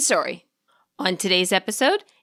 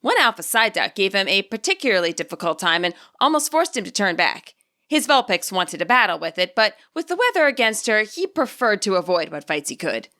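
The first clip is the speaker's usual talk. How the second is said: plain reading voice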